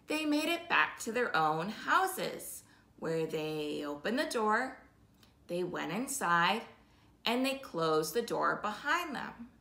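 usual speaking voice

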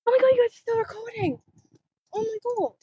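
high, quick burst of real surprise; astonished. Real, not acted. Vlogger discovers the viewers are still recording, astonished. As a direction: blurt it out, amazed and relieved.

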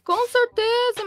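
high, slow and emphatic; drawn-out emphatic yes. Acted. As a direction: stretch it out with big agreement. Father agrees with daughter.

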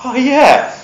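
high, sudden oh-yeah of realization. Retold or imitated. McBain realizes he can still talk, high sudden oh-yeah. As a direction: realize he was fine all along. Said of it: "Remember, remember?".

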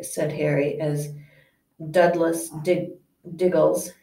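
ordinary delivery

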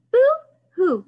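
playful fake cry; mock crying. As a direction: ham up a baby boo-hoo. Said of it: "if we say boo-hoo".

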